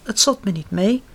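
ordinary delivery, nothing marked

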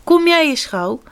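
storybook mouse calling out. A narrator's reading. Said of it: "ze riep tegen Pat".

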